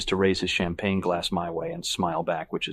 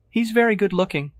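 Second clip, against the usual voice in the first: high-pitched, louder gushing; admiring; 13 semitones higher, 6 dB louder than usual. A narrator's reading. Woman admiring another man to her boyfriend, admiring. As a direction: confide it with open admiration. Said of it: "Evelyn confides admiringly".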